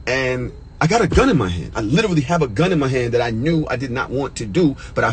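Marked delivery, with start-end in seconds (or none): none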